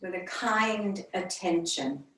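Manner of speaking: unhurried meditation voice with long pauses between phrases; yoga teacher leading a calm body scan; guided meditation. Real, not acted. Leads a centering meditation.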